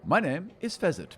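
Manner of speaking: a courtly little halfling introducing himself. Acted. GM voices the halfling.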